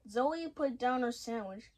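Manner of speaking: ordinary delivery, nothing marked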